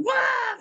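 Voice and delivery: very high mock shriek; imitated scream. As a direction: shriek "wah" as the startled victim. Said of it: "Wah, dia anjir itu". (jeritan tiruan melengking)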